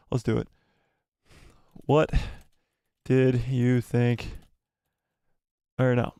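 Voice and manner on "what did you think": slow, spaced-out words as you type; slow typing dictation. Real, not acted. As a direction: dictate it word by word to yourself. Dictating a poll while typing it.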